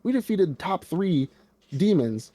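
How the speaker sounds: high, loud mock cheer; hyping them up. Telling the crow how it should have cheered them on, high and loud. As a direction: gas them up like a hype man. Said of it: "You should have been like".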